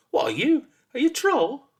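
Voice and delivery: high, loud, eager questions; excited. A narrator's reading. Boy quizzing the monster he hoped for, excited. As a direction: pitch it up, thrilled to find out.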